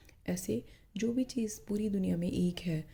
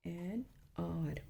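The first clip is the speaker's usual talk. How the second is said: slow modelled answer; 8 dB quieter, much slower than usual. Shown as a demonstration. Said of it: "तो इसके आगे हम करेंगे एन".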